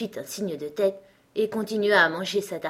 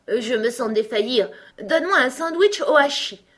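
high, loud plea from a king; king feeling faint; 6 semitones higher, 6 dB louder than usual. A narrator's reading. King begs his messenger for food, feeling faint, pleading. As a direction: plead dramatically, pitch climbing.

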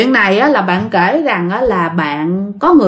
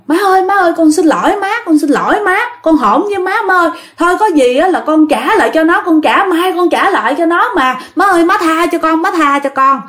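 desperate pleading; 7 semitones higher than usual. Retold or imitated. Begging ghost to stop strangling.

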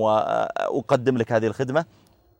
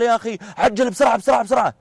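much higher, pushy mock shout of bosi-ness; rude impatient demand; 10 semitones higher than usual. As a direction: hurry the clerk rudely, hammed up. Rude passenger rushing the clerk. (مطالبة فظة بصوت عالٍ متعجل)

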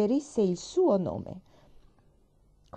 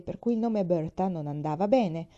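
plain reading voice

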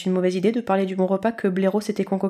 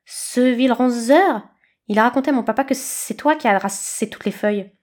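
hissing snakelet; 3 semitones higher than usual. A narrator's reading. Snakelet replies.

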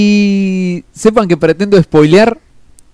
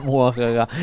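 low, soft evil villain cackle; mock evil laugh, deep and hushed; mustache twirling villain; 7 semitones lower, 8 dB quieter than usual. After "héroes con tendencias oscuras.

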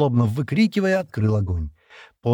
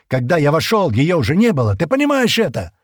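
loud, furious outburst rising in pitch; angry growl; 5 semitones higher, 5 dB louder than usual. A narrator's reading. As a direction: shout it back angrily, building higher. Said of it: "прорычал Стэн". (яростный крик с рычанием)

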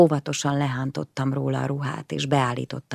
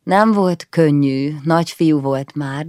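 ordinary delivery

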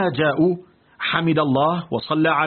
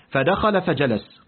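usual speaking voice

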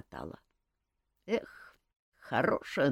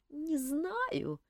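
drawled; 13 semitones higher, 8 dB quieter than usual. A narrator's reading. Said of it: "протянула Камила".

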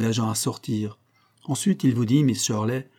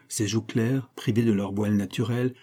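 plain reading voice

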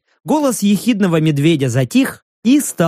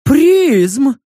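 high creature voice; 9 semitones higher, 4 dB louder than usual. A narrator's reading. Creature Prizm answers.

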